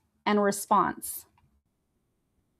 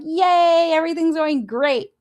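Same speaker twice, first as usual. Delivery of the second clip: mock excitement; 6 semitones higher than usual. Believer whose life is going great, mock excited. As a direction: cheer it high, hammed-up glee. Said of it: "you're all excited and you're like".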